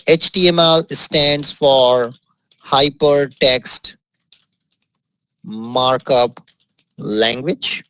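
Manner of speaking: slow, word-by-word dictation with long pauses; dictating while typing. Real, not acted. Teacher typing a definition for the class, slow dictation. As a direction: spell it out slowly as you type.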